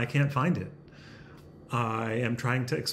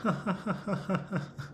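soft breathy stifled laugh; 6 semitones higher, 6 dB quieter than usual. Real, not acted. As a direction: laugh held back under the breath. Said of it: "I'm laughing on the inside".

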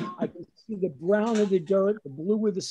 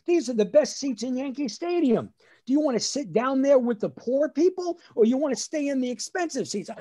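proud dad boasting, higher pitch; 5 semitones higher than usual. Retold or imitated. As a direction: brag like a proud father. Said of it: "my dad says very proudly".

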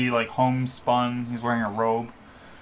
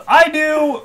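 high, loud call from across a crowd; 10 dB louder than usual. Acted. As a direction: call it out to someone far off. Someone answers from across the crowd.

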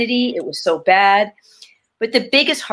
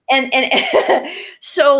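laughing; 6 semitones higher, much slower than usual. Real, not acted. Laughs at own irony.

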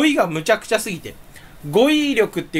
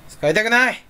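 barked outburst; 5 semitones higher than usual. Real, not acted. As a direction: blurt it out like a growl. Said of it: "吠えた。".

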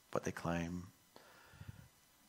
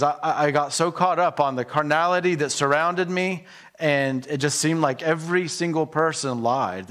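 louder and higher, pushing the point home; fervent preaching; 5 semitones higher, 7 dB louder than usual. Acted. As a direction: rise into full pulpit fervor. Preacher paraphrases the psalmist with force.

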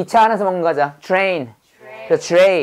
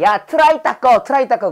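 higher-pitched mock army command; army order; 6 semitones higher than usual. Soldier telling another to clean the trays, mock army order. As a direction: call it out like ordering a junior soldier. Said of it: "그래서 군대 가면 먹는 식판 있죠".

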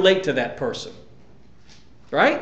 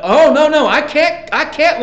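loud, high mock refusal; mock helpless protest; 8 semitones higher, 6 dB louder than usual. Believer refusing to line up with God, mock helpless.

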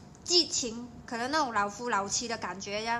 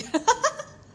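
giggle, embarrassed at herself; sudden high embarrassed giggle; 12 semitones higher, 5 dB louder than usual. Real, not acted. Laughs at own Cantonese.

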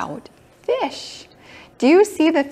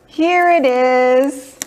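surprised; 5 semitones higher, 7 dB louder, much slower than usual. Acted. Finds the hidden fish.

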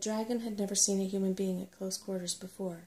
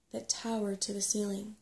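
ordinary delivery

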